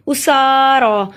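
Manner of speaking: उसारा very slowly, both सा and रा held; slow recitation of उसारा with long vowels. Shown as a demonstration. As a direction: stretch सा and रा in उसारा. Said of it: "सीन और रॉ को इसलिए लंबा किया".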